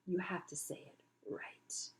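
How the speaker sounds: a sly whispered demand; a spider's scheming hushed whisper; malicious whisper. Acted. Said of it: "a glint of malice".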